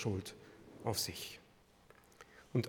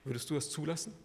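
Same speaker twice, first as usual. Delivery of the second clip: quiet probing question; 6 dB quieter, faster than usual. Real, not acted. Preacher asks the congregation what they would allow, quietly probing. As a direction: ask it softly, letting it sink in.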